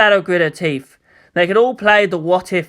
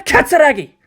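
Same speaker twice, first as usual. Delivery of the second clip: sharp warning; 9 semitones higher, 4 dB louder than usual. Acted. Two officers warning her.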